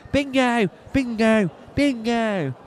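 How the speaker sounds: high-pitched cry of a winner; excited calling out. Acted. Bingo player announcing a win to the hall, excited high call. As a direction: call it out high, each one drawn to a fall.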